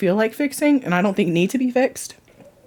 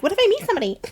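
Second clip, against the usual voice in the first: mock hopeful squeak; 12 semitones higher, much faster than usual. Mocking her own hopeful thought.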